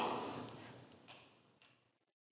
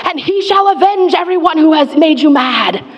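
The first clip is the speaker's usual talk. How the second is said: higher, building to a shout of vengeance; mock triumphant proclamation; 7 semitones higher than usual. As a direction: proclaim the grand avenging ending, half-joking. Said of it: "I want a bigger ending".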